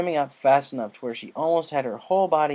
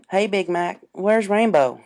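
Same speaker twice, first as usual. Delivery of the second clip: Applejack asking; 7 semitones higher, 7 dB louder, slower than usual. Acted. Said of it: "she asked".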